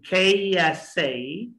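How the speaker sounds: very slow K S A as "khay-yes-say"; drawn-out K S A for copying; say after me. Shown as a demonstration. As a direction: stretch and link K S A. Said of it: "try to say after me".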